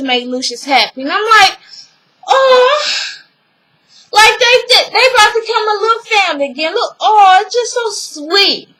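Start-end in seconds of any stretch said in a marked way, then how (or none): 2.3-8.7 high-pitched "aww" gushing; touched cooing. Retold or imitated. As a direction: coo over something sweet. Gushing over the family moment.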